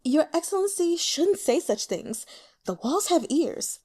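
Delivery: a courtesan soothing her client; coy courtesan. Acted. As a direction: hush him playfully. Courtesan warning the elder.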